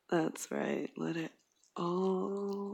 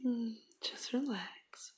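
tender whisper; 4 semitones higher than usual. Acted. Girlfriend comforting her partner, tender whisper. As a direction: soothe him in a whisper. Intimate roleplay.

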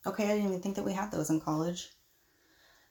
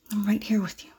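seductive whisper; 4 dB louder than usual. Acted. Seducing the professor.

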